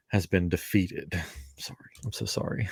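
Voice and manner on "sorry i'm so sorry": whispering. Real, not acted. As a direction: whisper it so as not to interrupt. Apologizing while someone is talking.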